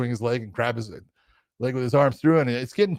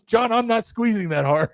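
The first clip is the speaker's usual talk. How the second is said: high-pitched mock protest; 7 semitones higher, 4 dB louder than usual. Craig to referee after second choke, high protest. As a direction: imitate a fighter defending himself, pitch raised.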